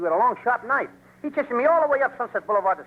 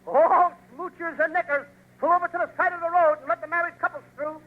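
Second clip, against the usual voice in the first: mock sound-truck announcement, pitched up; hollered traffic message; 7 semitones higher than usual. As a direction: holler it out to passing motorists. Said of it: "He hollers, uh".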